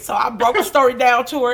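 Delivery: normal talking voice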